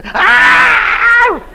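loud, high-pitched imitated scream; sudden piercing mock scream. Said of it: "her still screaming".

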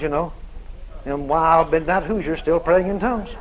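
low-pitched voice reporting the trouble; hotel manager. Retold or imitated. Hotel manager asking them to leave.

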